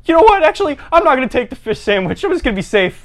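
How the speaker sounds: high voice settling down; timid diner. Acted. Diner changing order to please Chad, high and nervous. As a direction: back out nervously in a put-on voice.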